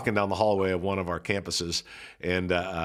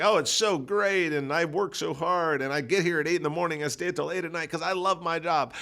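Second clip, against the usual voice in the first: raised, lightly mimicked gushing staffer; 8 semitones higher than usual. Staffer gushing to her pastor, mock eager and high. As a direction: gush about loving the job, voice lifted high. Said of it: "She went on and on".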